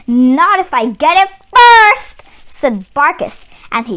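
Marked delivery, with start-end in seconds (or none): 0.1-2.2 louder, higher angry vow. Acted. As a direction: snap it back in a temper. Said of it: "stalked off angrily".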